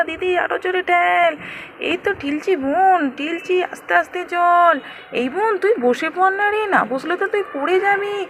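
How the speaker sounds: put-on high, excited child's voice; a small sister urging her sister on; little girl voice.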